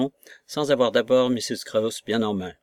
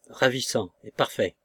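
plain reading voice

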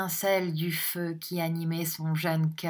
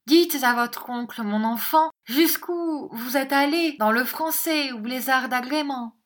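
higher, louder woman's voice, urging; mother prompting her daughter; 8 semitones higher, 6 dB louder than usual. Acted. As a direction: coax the child to speak up, pitched high. (voix de mère insistante, aiguë)

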